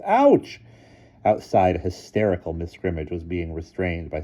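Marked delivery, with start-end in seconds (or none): 0.0-0.6 high loud ouch; yelp of pain. A narrator's reading. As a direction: cry out as you fall. Boy falls among cans.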